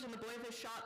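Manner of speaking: ordinary delivery, nothing marked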